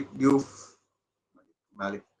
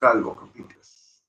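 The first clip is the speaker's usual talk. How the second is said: ordinary talk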